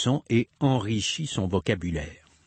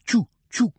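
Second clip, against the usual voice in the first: whispered choo-choo, much higher; train noise; 12 semitones higher, 4 dB louder than usual. Pretend. Parent making train sounds for a child, whispered puffing. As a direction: puff like a toy train. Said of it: "fais le train".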